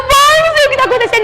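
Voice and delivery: frantic, high, rushed plea; acted desperation. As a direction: blurt it out fast and high, re-enacting panic. Acted frantic plea during prayer. (súplica desesperada, aguda e apressada)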